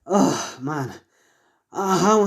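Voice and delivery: panting after a long run; winded, gasping between words; out of breath. Acted. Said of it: "He's sweating heavily".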